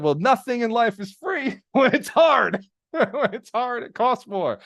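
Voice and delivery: high, slow, laughing line; amused, laughing delivery; laughing through it. Retold or imitated. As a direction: chuckle through the punchline. Laughing while quoting himself.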